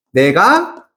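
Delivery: normal talking voice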